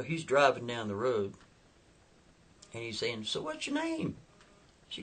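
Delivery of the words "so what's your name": very high put-on voice; voiced question. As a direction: ask in an imitated, high-pitched voice.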